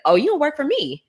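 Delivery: high surprised exclaim as Tasha; imitating a surprised boss; mock surprise. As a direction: act her being taken aback.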